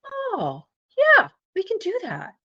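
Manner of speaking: high-pitched, delighted realisation; retold burst of can-do enthusiasm; eager agreement. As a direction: jump at it, voice leaping way up. Retold delighted answer to the CEO.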